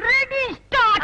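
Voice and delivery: high, sharp cue to begin; calling out the start. Acted. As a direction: shout it high like a director's cue. Calls the start of the scam.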